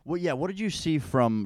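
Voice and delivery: ordinary delivery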